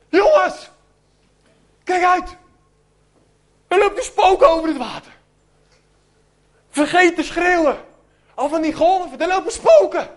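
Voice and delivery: high, loud frantic yell; screaming warning. Acted. Peter warns the boys of a ghost, high frantic yell. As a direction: scream it across the deck in terror. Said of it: "te gillen".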